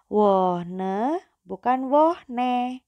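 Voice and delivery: Wohne then wohne, very slow; slow contrast. Shown as a demonstration. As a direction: contrast the o vowels slowly. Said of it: "jangan lupa ya".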